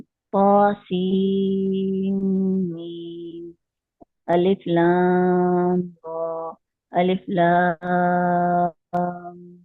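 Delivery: अलिफ लाम रा stretched, लाम held six counts; slow Quranic recitation of the letters; drawn-out recitation. Shown as a demonstration. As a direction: recite slowly, holding the madd and ghunna. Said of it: "उसी तरह पढ़ना है जिस तरह हमने".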